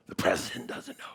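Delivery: whispered disbelief; awed whisper. Retold or imitated. Preacher recalls reacting to the leader's confession, awed whisper. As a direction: whisper it like scandalous news.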